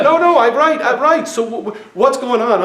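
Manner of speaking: mock-panicked husband insisting he's right; flustered yell. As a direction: blurt it out, confused and defending. Flustered husband in retold argument.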